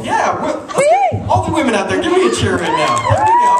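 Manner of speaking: high, loud hype shout; rallying the crowd. Real, not acted. Comedian asking women in the audience to cheer, real shout.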